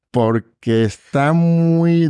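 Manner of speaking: high, very slow drawn-out words; slow hesitant dictation. Retold or imitated. Judge dictating to the clerk, high and slow. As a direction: stretch it out while groping for a word.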